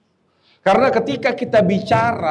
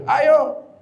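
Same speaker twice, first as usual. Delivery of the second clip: challenging neighbour; 7 semitones higher than usual. Retold or imitated. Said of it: "kata dia".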